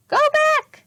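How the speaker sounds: very high, loud parrot voice; squawking parrot. A narrator's reading. Polly the parrot warning the children, high squawk. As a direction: screech it like a parrot. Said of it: "said Polly".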